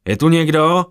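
loud call into an empty hall; shouting into a vast echoing temple. A narrator's reading. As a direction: call out, pitched high and loud. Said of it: "Zařval do prostoru".

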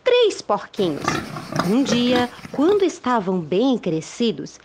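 lively storybook narration; reading to children. A narrator's reading. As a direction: tell it like a bedtime tale.

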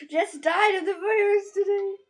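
sighing then half-crying the news; dismayed crying sigh. Real, not acted. Reading the bad news aloud, half crying. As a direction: let the shock break into a sob.

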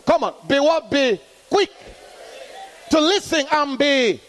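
high, ringing call-and-response preaching; full-force preaching. Acted. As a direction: drive the congregation to answer back. Preaching to congregation.

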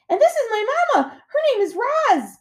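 very high, excited gosling call; shouting gosling. A narrator's reading. Gosling introducing his mama to the otters, shouting. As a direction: shout it across the water. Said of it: "he shouted over the waves".

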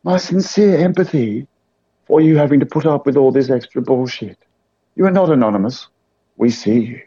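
a lightly put-on solemn official voice. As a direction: speak as a mock Anonymous spokesman, lightly. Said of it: "I even put on a voice".

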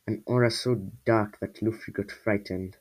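ordinary delivery

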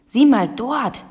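squawked alert, a hint of croak; croaking raven. A narrator's reading. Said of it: "krächzte Abraxas".

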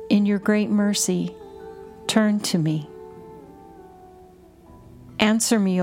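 ordinary talk

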